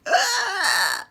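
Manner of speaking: very loud, high, strained groaning cry; terrifying loud groan, imitated; loud awful groan. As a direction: let out a god-awful choking groan. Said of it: "a really loud fucking noise".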